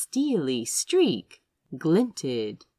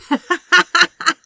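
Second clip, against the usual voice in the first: high, loud burst of laughter; delighted laugh; 12 semitones higher, 11 dB louder than usual. A narrator's reading. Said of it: "Crow had to laugh".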